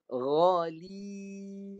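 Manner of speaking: Ghalidh recited with ra and dha stopped; teacher chants Ghalidh with the dha held still; recited demo. Shown as a demonstration. Teacher showing students a dead dha, recited. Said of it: "Dengar saya baca".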